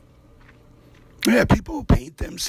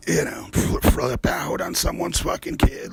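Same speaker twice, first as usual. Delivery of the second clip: usual speaking voice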